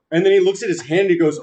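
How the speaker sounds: ordinary talk, nothing marked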